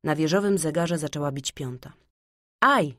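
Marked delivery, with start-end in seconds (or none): 2.6-2.9 short, high, louder "ouch"; sudden cry of pain. A narrator's reading. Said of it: "zawołał Kaj nagle". (nagły okrzyk bólu)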